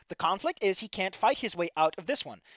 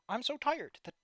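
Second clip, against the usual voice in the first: ordinary talk, nothing marked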